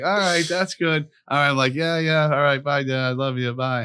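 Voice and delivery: lightly acted breezy brush-off; mock casual sign-off. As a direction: act out wrapping up a call as if all is fine. Retells ending an awkward phone call.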